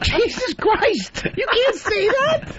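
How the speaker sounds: very high, drawn-out squeal of disbelief; acted shock. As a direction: stretch each word out, appalled and squeaky. Acting out his appalled reaction.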